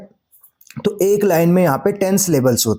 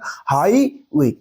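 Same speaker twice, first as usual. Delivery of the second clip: Highway slowly, long stressed हाई and light वे; weight on हाई, let वे fall away; highway pulled apart to show stressed हाई; stress on first syllable; 4 semitones lower, much slower than usual. Shown as a demonstration. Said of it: "वे में इतना stress नहीं पड़ रहा".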